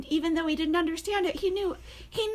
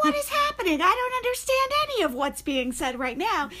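much higher and louder, totally lost; bewildered outcry; 7 semitones higher, 3 dB louder than usual. Retold or imitated. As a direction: act baffled by the movie dialogue. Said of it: "And I'll be like".

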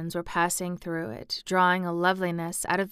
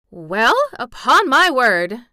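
housekeeper's slow amazed Yorkshire, louder; astonished Yorkshire; 5 semitones higher, 9 dB louder, slower than usual. A narrator's reading. Mrs Medlock overhearing the laughter, astonished Yorkshire. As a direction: gasp it out in broad Yorkshire. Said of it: "speaking rather broad Yorkshire herself".

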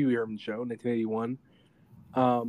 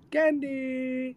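much higher squeaky "Candy"; Pee-wee voice; 11 semitones higher than usual. Retold or imitated. Pee-wee throwing candy to the bikers, squeaky. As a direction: toss the word out like Pee-wee.

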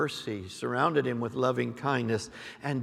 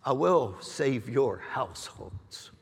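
prophetic declaring; 3 semitones higher than usual. Acted. Prophet to the church, stirring declaration. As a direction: proclaim each promise with force.

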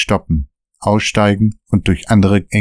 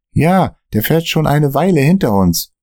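higher, worried sidekick voice; nervy friend glancing at the mirror; nervous Dan; 8 semitones higher than usual. Acted. Dan warning Michael about the car behind, nervous and higher.

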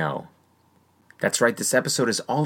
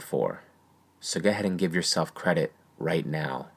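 guided meditation; 2 semitones lower than usual. Acted. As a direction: guide them inward gently. Leads a visualization exercise.

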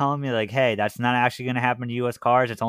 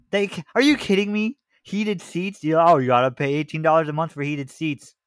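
incredulous rant; 6 semitones higher than usual. Real, not acted. Rants about paying for heated seats.

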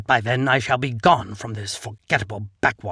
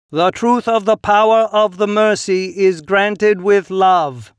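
loud, higher congregational reply; chorus response; 7 semitones higher, 7 dB louder than usual. A narrator's reading. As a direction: answer as a whole crowd in unison. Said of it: "they all respond in a harmonizing chorus".

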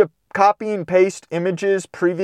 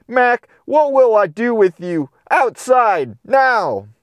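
raised, exasperated scolding; exasperated order; 6 semitones higher, slower than usual. Acted. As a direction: throw him out of the kitchen, fed up. Miss Smith ordering dog out.

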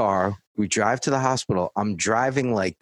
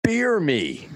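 high, sneering "Beer me"; mocking impression of a frat guy; mocking douchebag voice; 9 semitones higher than usual. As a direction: mimic the smug guy asking for a beer. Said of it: "and I quote".

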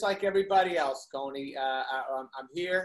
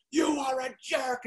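slow, higher shouted insult; mock angry lady; 6 semitones higher, slower than usual. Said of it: "She goes".

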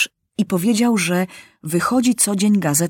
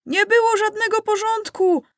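put-on much higher child's voice, indignant; 12 semitones higher than usual. A narrator's reading. Child deputy protesting at the noisy session, much higher. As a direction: complain like an outraged kid.

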